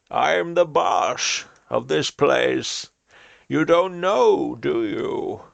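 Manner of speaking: high, slow put-on villain voice; drawn-out theatrical villain taunt; Bane impression. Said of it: "a Bane impression".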